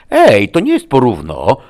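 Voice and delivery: loud, high indignant shout; yelling. A narrator's reading. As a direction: shout out a protest at being cheated. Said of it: "wrzasnął Harlan". (wrzask oburzenia)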